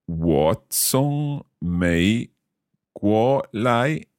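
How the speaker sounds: very slow, syllable by syllable; slow learner's repeat. Shown as a demonstration. Learner repeating the teacher's Chinese phrase, very slowly. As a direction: draw out each tone carefully. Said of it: "saying each of these phrases quite slowly".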